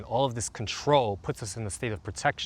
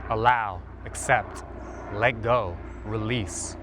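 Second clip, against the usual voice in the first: guided meditation; 2 semitones lower, 3 dB quieter than usual. Acted. Coach leading listeners through a calming routine, soothing meditation voice. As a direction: guide them gently into the body.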